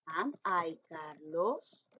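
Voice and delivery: very slow, deliberate question; slow dictation. Shown as a demonstration. Teacher modelling the question slowly for primary pupils. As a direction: lay out each word for the class. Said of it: "teacher reads model question".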